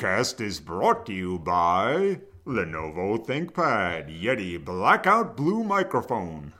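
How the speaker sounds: slow, loud sponsor read; mock announcer. Host plugging the podcast's sponsors, mock announcer. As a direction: draw it out like a booming radio plug.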